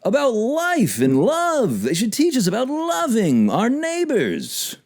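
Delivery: high, drawn-out mock sentimental; hammed-up heartfelt appeal, pitched high; mock-earnest plea. Mock-earnest plea about school lessons.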